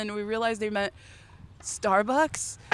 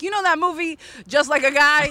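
retold eager question; 6 semitones higher, 5 dB louder than usual. Retold insistent question to friends.

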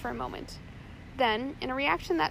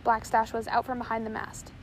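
ordinary delivery, nothing marked

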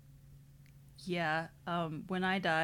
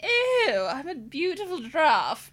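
high, loud, swooping character voice; hammed-up sitcom impression, pushed high; Eleanor Shellstrop; 9 semitones higher, 10 dB louder, slower than usual. Showing friend a TV character impression, high and loud. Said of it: "trying to do Eleanor Shellstrop".

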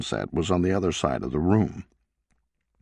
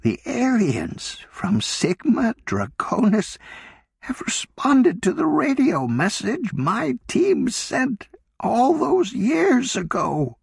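put-on slow, much higher older woman in awe; Sarah, awestruck; slower than usual. A narrator's reading. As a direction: announce the impossible news slowly, in wonder. Sarah reveals the alien reply.

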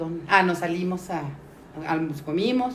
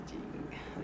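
a breathless whispered swear; a secret little whisper; whispered curse; 16 dB quieter than usual. Retold or imitated. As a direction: hiss the curse under your breath. Swearing under her breath at home.

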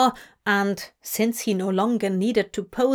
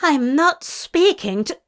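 angry, cut off; 6 semitones higher, 4 dB louder than usual. A narrator's reading. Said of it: "Aziraphale cut himself off".

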